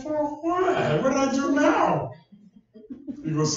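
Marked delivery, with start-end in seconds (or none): 0.0-2.1 high-pitched mock protest; retold startled why-me reply; indignant surprise. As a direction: act baffled and a bit put out. Protesting to God in retold story.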